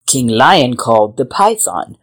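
ordinary delivery, nothing marked

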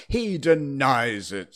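slow, loud royal ruling; loud, drawn-out declaration; pronouncing king. A narrator's reading. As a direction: announce it weightily to the court. King rules on the quarrel.

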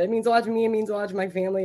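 ordinary delivery

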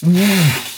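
imitated rush of wind; a sudden whoosh of air; whooshing gust. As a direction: blow a quick "woof" like a force hitting. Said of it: "It just went".

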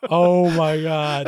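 loud, long drawn-out disbelief; amused astonishment. Real, not acted. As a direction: stretch it out loudly, floored by the joke. Floored by co-host's Yoshi joke.

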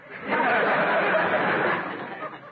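airy high snicker; a knowing breathy laugh; sly snicker. Real, not acted. Druggist mocking Sidney's excuse, sly airy snicker.

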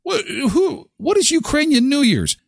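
high incredulous what; baffled, pitch way up; surprised. Retold or imitated. Shocked reaction to wife.

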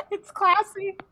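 giggly, high and bright; laughing. Real, not acted. Giggling about the holder.

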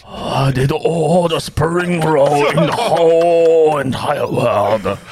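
slow put-on North Korean accent; a slow hammed-up North Korean dictator accent. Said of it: "Can you say that in a North Korean accent?".

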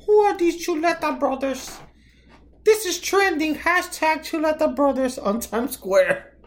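high, hyped-up onlooker voice; mock bystander voice. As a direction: play an amazed passerby. Mock bystanders reacting to tag.

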